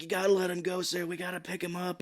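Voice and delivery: retold rescuer's urging; calling out. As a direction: plead over the noise like a rescuer.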